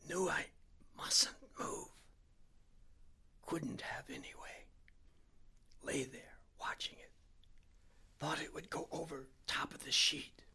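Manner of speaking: slow, halting whisper, word by word; terrified whisper, pausing between phrases; slow careful whisper. A narrator's reading. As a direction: whisper each word without moving your stomach. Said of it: "he spoke very slowly".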